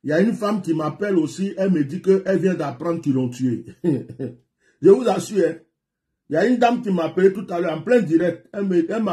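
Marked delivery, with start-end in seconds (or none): 3.7-4.3 short laugh. Real, not acted. Laughs at rumour of death.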